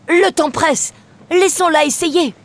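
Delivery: put-on high, urgent young voice; urgent boy. Sam urging the pirates to let her try, urgent. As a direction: press them to hurry. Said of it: "Sam sortit de sa cachette et dit".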